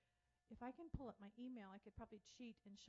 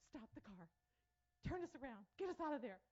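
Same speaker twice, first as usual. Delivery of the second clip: alarmed demand; 3 semitones higher, 5 dB louder than usual. Retold or imitated. Panicked demand near dangerous town.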